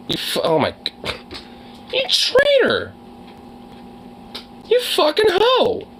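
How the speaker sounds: very high-pitched, indignant scolding; outraged squeal. Real, not acted. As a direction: squeal at the little traitor running off. Scolding pet that ran away.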